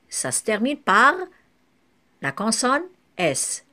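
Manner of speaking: ordinary talk, nothing marked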